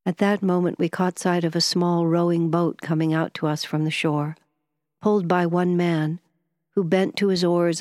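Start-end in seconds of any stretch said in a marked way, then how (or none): none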